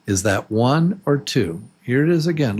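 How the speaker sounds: ordinary talk, nothing marked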